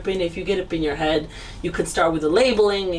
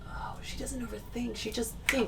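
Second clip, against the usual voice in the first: breathy whisper of an imagined inner voice; whispered thought; 3 semitones higher, 12 dB quieter than usual. Retold or imitated. Host whispering the guest's imagined calm thoughts, hushed. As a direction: hush it like a private thought.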